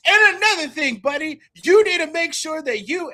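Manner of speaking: loud, high lecturing yell; high shouted telling-off; scolding shout. Acted. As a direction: chew the mimic out loudly. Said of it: "and I go".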